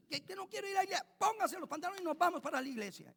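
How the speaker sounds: high, loud mock scolding; mock-stern parent ordering the kid.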